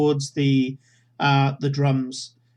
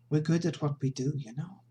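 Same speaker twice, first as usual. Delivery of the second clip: weary character voice; faster than usual. Acted.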